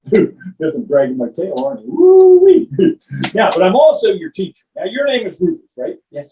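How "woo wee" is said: loud high woo-wee of delight; excited whoop. Acted. Dragon teacher whooping at the dog's trick. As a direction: whoop with glee.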